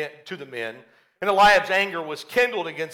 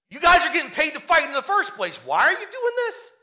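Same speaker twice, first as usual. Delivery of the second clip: incredulous; 10 semitones higher than usual. Acted. David to soldiers paid to fight, incredulous. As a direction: push the question up high, baffled. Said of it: "It's almost like incredulous".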